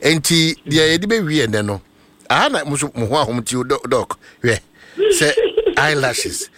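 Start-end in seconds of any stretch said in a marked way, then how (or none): 5.0-6.5 high squeal of laughter while listing; giggling through eyelashes; laughing. Real, not acted. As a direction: laugh the word out, slowly. Teasing list of makeup items.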